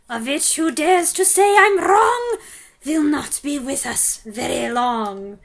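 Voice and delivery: put-on loud, accented menacing rhyme; terrible recital. Grand High Witch reciting a death sentence, menacing. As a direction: deliver the final sentence. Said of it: "her terrible recital".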